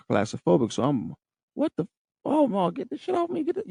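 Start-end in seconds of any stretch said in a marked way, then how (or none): 1.6-3.7 panicked. Retold or imitated. Panicking at the mask on his face.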